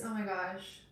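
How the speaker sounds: ordinary talk, nothing marked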